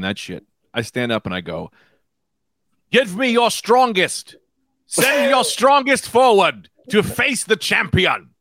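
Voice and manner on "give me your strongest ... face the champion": booming, drawn-out challenge, pitched high; slow, high, theatrical battle cry; grand challenger. Acted. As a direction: proclaim it slowly like an arena fighter calling out rivals. Stands up to issue a challenge.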